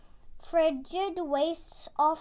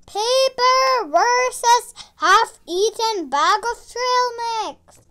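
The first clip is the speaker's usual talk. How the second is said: loud, higher dramatic announcement; fight announcer; 6 semitones higher, 8 dB louder than usual. Acted. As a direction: announce the matchup with a boom. Announcer names the foe.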